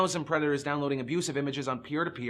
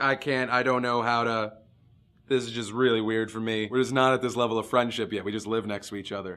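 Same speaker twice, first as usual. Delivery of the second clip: lowered, hesitant put-on neighbor voice; awkward neighbor; 5 semitones lower than usual. Vlogger mocking the neighbor who called police, lowered uneasy voice. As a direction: play the squeamish neighbor who won't help. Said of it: "they were like".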